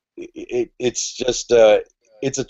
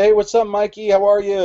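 much higher, eager hello to a friend; greeting an old buddy, pitched up high; retold cheery greeting; 10 semitones higher than usual. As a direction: call out hello like you're glad to see him.

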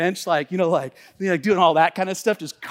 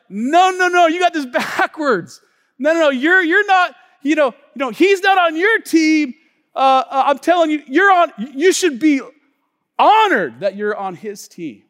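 loud, high insistence; fired-up correction; emphatic shout; 8 semitones higher, 8 dB louder than usual. Retold or imitated. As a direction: shout them down, no no no.

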